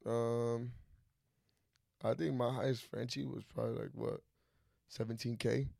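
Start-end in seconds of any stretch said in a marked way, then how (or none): 2.1-5.7 hushed. Real, not acted. Naming big sale price.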